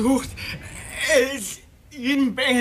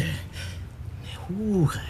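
whispered shame; 2 semitones lower, 6 dB quieter than usual. Acted. Suspect murmurs shameful word.

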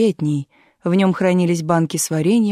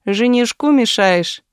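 put-on teasing boy; 5 semitones higher, 4 dB louder, slower than usual. A narrator's reading.

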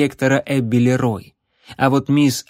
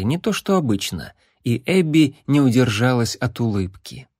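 usual speaking voice

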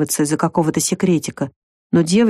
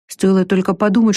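ordinary delivery, nothing marked

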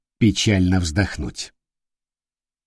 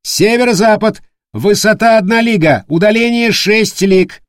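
high, loud lookout report; shouting; 11 semitones higher, 4 dB louder than usual. Acted. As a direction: call the numbers out loud. Lookout calling out sightings.

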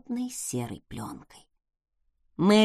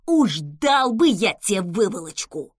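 put-on angry old man shout; 5 dB louder than usual. A narrator's reading. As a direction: bellow like a cranky gardener. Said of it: "крикнул он".

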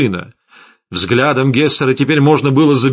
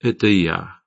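gloomily; 3 semitones lower than usual. A narrator's reading. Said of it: "мрачно сказал Витислав".

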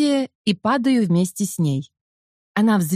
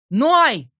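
shouting; 6 semitones higher, 3 dB louder than usual. A narrator's reading. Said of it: "раздаётся очередной крик".

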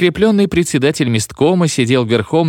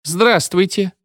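ironic; 9 semitones higher, slower than usual. Acted. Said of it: "иронически сказал Мервухин".

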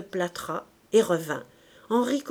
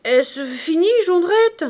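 loud, high waking question; startled drunkard stirring awake; groggy stammer; 9 semitones higher, 8 dB louder, slower than usual. A narrator's reading. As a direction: blurt it out as if just woken. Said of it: "Il s'éveilla en balbutiant".

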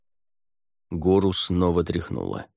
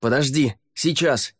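raised, higher-pitched urgent reply; calling back; 6 semitones higher than usual. A narrator's reading. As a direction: shout back to make her wait. Calling back during rescue.

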